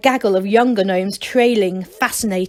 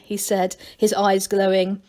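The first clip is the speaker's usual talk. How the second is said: ordinary delivery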